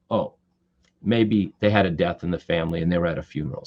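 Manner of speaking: ordinary talk, nothing marked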